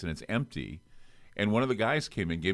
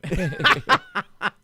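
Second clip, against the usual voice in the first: big laugh; 7 dB louder than usual. Real, not acted. Laughs at punchline.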